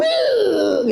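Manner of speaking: high drawn-out crowd whoop; retold crowd shout. The audience reacts to his winning kick, high imitated whoop. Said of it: "audiens teriak semua".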